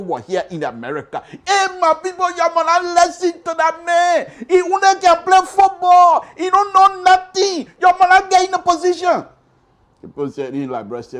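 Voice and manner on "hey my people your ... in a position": high, loud, urgent cry; the host warning voters against the footballer, shouting. Retold or imitated. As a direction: call out to the whole nation. Warning the people loudly.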